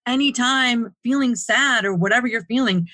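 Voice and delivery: a caring nudge to a stranger who looks dead inside; tender plea. Retold or imitated. Wanting to hug sad coworkers.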